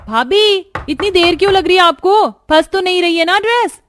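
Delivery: calling out. Acted. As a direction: raise your voice to reach the next room.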